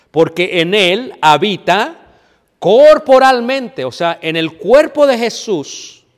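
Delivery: slow, loud, rising preacher's proclamation; preaching at full force. Acted. Preacher proclaiming God dwells bodily in Jesus, slow and loud. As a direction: pound each word out from the pulpit.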